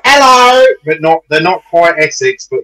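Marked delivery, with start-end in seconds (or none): 0.0-0.6 high, loud mock greeting; Northampton woman voice. Imitating how local women talk, high loud voice. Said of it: "women are very like".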